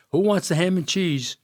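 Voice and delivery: calling out sandwiches. Retold or imitated. Calling out at wedding.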